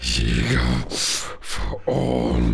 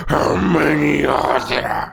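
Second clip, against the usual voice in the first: much higher, louder angry demand; furious demand; 4 dB louder than usual. Acted. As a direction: force the answer out of him. Villain demanding an answer.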